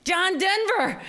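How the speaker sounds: high, loud delighted cry; thrilled laughing exclamation; excited. Retold or imitated. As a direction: squeal the name in starstruck glee. Thrilled recognition of celebrity.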